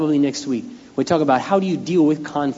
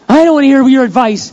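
much higher, louder mock yell of the upset wife; hammed-up shout through the door; exaggerated yell; 10 semitones higher, 9 dB louder than usual. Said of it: "She wasn't really yelling that loud".

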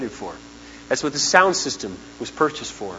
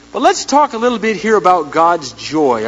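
usual speaking voice

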